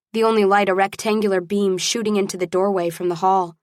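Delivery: plain reading voice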